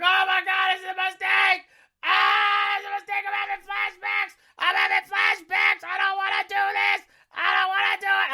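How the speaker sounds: high-pitched hammed-up scream; panicked screaming. Rider reenacting panic on the Mummy ride, high screaming. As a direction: shriek in mock panic, wailing the words. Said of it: "I'm screaming".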